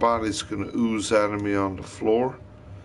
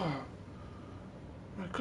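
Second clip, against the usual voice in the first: whisper; 8 semitones higher, 18 dB quieter than usual. Real, not acted. Whispered aside in the story.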